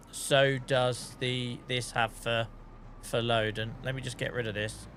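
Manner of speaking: ordinary talk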